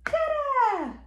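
high Dada sliding down; playful drawn-out high cheer; exclaimed refrain. Acted. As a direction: sing it out high for baby. Parent cheers book's refrain to child.

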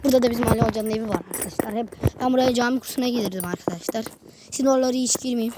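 normal talking voice

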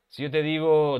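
ordinary talk, nothing marked

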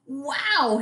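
high, stretched-out wow; amazed wow. Acted. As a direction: swoop it up in wonder. Octopus amazed at the depth.

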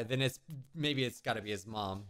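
ordinary talk, nothing marked

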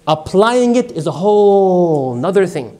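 ordinary delivery

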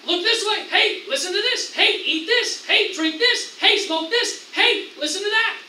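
high, loud, insistent hawking; punchy, pestering shouts from a tempter; pushy tempter Satan. Acted. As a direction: goad them with one shouted temptation after another. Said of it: "Satan says".

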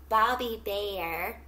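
usual speaking voice

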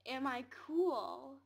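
loud, drawn-out asking; hopeful big question. Real, not acted. Asking the game for approval, loud and eager. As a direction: stretch it out loudly, rising at the end. Asks the game, then repeats it.

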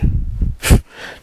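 short, voiceless snort; derisive snort. A narrator's reading. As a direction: snort in contempt. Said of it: "Jake snorted".

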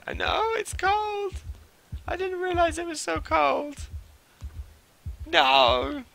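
very high, drawn-out comic wail; slow, hammed-up falsetto whine; mock despair. Streamer finding the game shelter too cold, wailing in mock despair.

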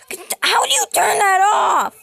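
high frantic yelling; frantic shout. Real, not acted. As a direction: shout in desperation.

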